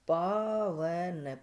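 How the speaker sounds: पावन stretched very slow, each sound held; teacher slowly spelling out पावन; slowly drawn out. Shown as a demonstration. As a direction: draw out पावन syllable by syllable so each sound is heard. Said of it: "पावन में देखना".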